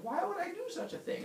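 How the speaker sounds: high, squeaky disbelief; appalled question. Retold or imitated. Heracles learning what he did, high appalled cry. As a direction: wail it in comic bewilderment.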